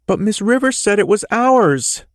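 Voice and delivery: put-on high, rising whiny boy's voice; little boy getting worked up; child winding up. A narrator's reading. Boy protesting to his dad, getting upset. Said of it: "his voice winding up".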